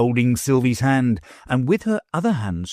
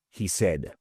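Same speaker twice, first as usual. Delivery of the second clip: ordinary delivery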